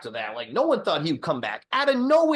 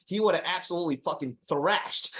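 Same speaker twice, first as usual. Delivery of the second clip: ordinary talk, nothing marked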